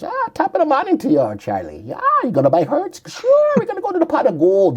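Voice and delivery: hammed-up stage Irish leprechaun; Irish accent. Host joking about lucky Hertz buyers in mock Irish accent. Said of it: "luck of the Irish".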